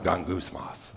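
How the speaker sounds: gangusmas said very low and hushed, almost under the breath; weird-sounding Greek word. Shown as a demonstration. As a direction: murmur gangusmas low like a grumble. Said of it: "a weird kind of sound".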